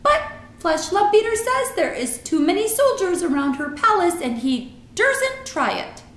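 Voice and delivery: gossiping giant, high and emphatic; BFG. A narrator's reading. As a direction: relish the report as a friendly giant.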